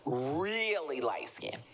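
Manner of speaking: slow repeat, "really" stretched out; stress on really. Real, not acted. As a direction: draw "really" out long to insist.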